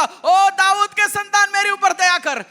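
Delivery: loud, slow, high cry for mercy; shouting out. Retold or imitated. Blind man shouts to Jesus over crowd, loud slow cry. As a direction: call out to him across the crowd, slow and loud. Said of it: "वो चिल्ला के बोला".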